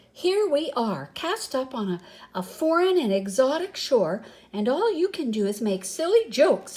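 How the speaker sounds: angry snap. A narrator's reading. Humphrey snaps at Samson for making silly jokes. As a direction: scold them for their silly jokes. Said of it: "he snapped".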